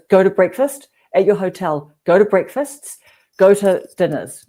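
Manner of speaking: emphatic advice. Retold or imitated. Quoting Daryl's advice, emphatic.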